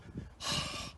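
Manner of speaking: soft breathy hiss; owl warning hiss. Retold or imitated. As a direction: hiss back softly like a wary owl. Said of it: "which is his way of saying".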